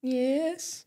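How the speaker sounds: high, put-on sarcastic yes; mock agreement. Kiwi pretends to be Australian to a stranger, mock yes. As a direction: fake going along with it. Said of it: "And you're like".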